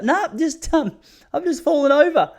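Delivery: high, put-on old auntie voice, brushing it off; Auntie Joy. Brother-in-law quoting Auntie Joy at the beach, high old-lady voice. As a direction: mimic the auntie sweetly denying the current.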